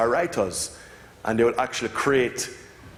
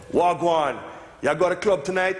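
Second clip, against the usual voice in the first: higher, in a light Jamaican patois; 6 semitones higher than usual. Retold or imitated. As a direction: switch into easy Jamaican patois. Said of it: "And you say".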